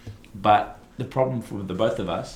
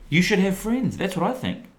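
mock passionate; 12 semitones higher than usual. Mock passionate declaration, high pitch.

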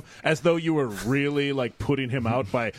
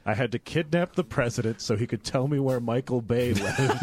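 normal talking voice

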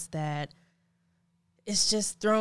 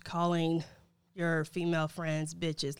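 normal talking voice

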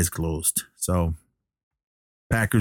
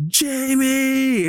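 very high, long drawn-out cry; retold anguished call. Billy realizing it's his brother, imitated anguished cry. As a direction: imitate a heartbroken call on the verge of tears. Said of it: "he's getting ready to cry".

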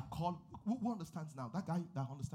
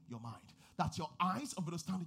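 ordinary talk

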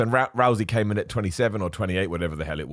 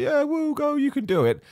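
much higher mock cheer; cheering fan. Mocking fans behind Roman Reigns, high cheering voice. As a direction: imitate the crowd cheering him on. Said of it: "everybody's behind him and like".

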